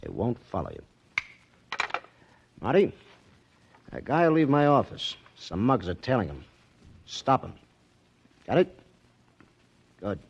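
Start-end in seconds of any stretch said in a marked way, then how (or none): 2.6-5.2 louder, firm command; loud order. Acted. Casino owner orders Marty, loud and firm. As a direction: give the order with weight.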